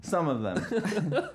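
laughing while repeating the phrase; half-laughing echo. Real, not acted. Mocking quote, laughing.

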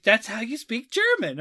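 a hint of a confident little kid; imitated young daughter. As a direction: lightly play a child declaring a fact. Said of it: "she was like".

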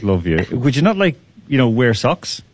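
ordinary talk, nothing marked

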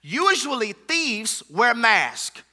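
normal talking voice

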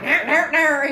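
loud, high-pitched nonsense yammering; hammed-up squawky yelling; mock yelling. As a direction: imitate people yelling at each other, laughing. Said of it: "everybody yells at each other".

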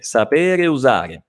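slow "sapere usare" with the final E of "sapere" sounded; drawn-out "sapere usare", E running into U; full-vowel form. Shown as a demonstration. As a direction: keep both vowels where "sapere" meets "usare". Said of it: "è come se fosse sapere".

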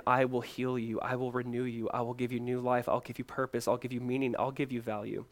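hushed, steady reassurance; gentle voice of God. Acted. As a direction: speak low and softly, reassuring. Voices God's tender promises.

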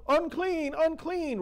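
high, loud cry of unclean; leper's cry. Retold or imitated. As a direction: call it out like the leper warning all. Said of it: "yelling out".

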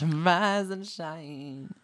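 hammed-up British, very long vowels; mock British accent. Shown as a demonstration. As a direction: parody a famous Brit, stretching every word. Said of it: "famously British".

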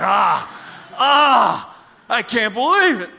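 loud, high shocked yell at the driver; angry outburst. Acted. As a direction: blow up at the driver who wrecked your car. Car owner's outburst at the crash.

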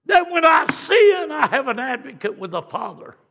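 high, loud revival preaching; full-force preaching. Real, not acted. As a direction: preach it hard and lifted.

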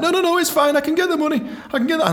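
high, squeaky fake confidence; strained casual lie; nervous bluff. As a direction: cover a lie badly. Lying about the money.

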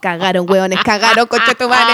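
loud joking curse; mock outraged shout.

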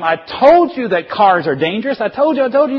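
high, loud, frantic scolding; acted outraged parental shout; screaming parent. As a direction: yell at a son after a near miss. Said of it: "you're screaming at him".

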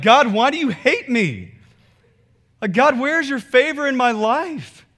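loud, high mock lament; exaggerated wail of a fed-up believer; grumbling at God. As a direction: complain to heaven, hammed up. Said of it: "now you're grumbling".

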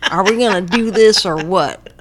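imitating George Bush. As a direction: belt it out like Bush gnawing a chicken bone. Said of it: "While he's sucking on a chicken bone".

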